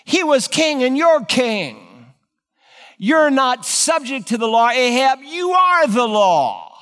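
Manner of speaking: a queen scorning her weak husband, rising to a shout; taunting scornful wife. Acted. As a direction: goad him, climbing to a yelled finish. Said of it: "this evil woman is hammering home".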